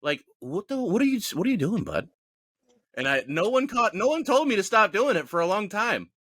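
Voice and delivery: ordinary talk, nothing marked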